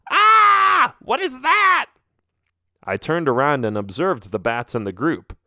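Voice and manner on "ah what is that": high, loud panicked shriek; frightened scream. Acted. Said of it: "I heard one of the bats scream".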